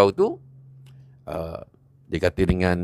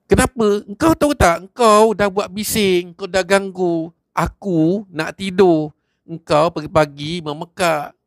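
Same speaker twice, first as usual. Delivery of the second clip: slow angry scolding; 7 semitones higher, slower than usual. Acted. A young man slowly scolds Haji Hamid, high and heated. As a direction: drag out each complaint at him.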